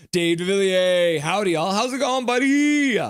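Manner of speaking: excited greeting. Real, not acted. Streamer welcoming a viewer from South Africa, exuberant greeting. Calls out hello to arriving viewer.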